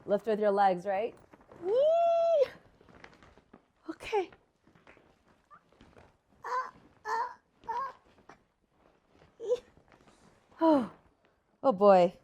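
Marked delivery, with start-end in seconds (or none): 1.7-12.1 effort grunt. Real, not acted. Lifting the heavy bag.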